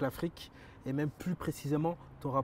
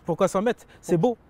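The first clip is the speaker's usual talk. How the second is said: higher, louder cheering agreement; host cheering the guest's lack of limits, excited; excited approval; 6 semitones higher, 9 dB louder than usual. Real, not acted. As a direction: cheer him on, thrilled.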